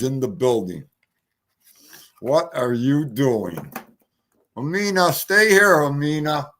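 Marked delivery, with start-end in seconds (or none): none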